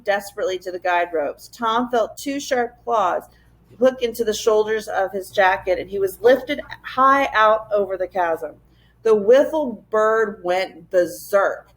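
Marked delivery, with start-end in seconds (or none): none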